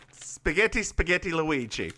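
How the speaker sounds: high cartoon Italian accent; sing-song fake Italian accent, hammed up; Mario. Co-host clowns as game plumber, silly Italian accent. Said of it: "It's Mario".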